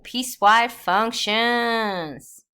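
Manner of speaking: loud, slow announcement; announcing. Real, not acted. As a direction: call out the new topic slowly. Announcing new topic.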